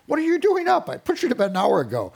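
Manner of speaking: high, loud exasperated scolding; exasperated parent. Retold or imitated. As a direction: bust the child out of bed, loud and high. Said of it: "You're like".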